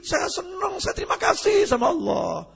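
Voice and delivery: mock joyful outcry from a child. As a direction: burst out happily like a little boy. Child cries out in joy.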